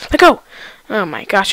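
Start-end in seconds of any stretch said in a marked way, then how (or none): 0.1-0.4 loud, high urgent shout; frantic shout. Real, not acted. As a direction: yell it urgently. Gamer frantic mid-fight.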